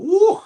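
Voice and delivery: high woo. Retold or imitated. Sun burning your neck.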